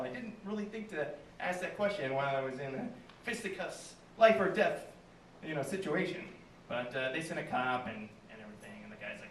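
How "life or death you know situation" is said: usual speaking voice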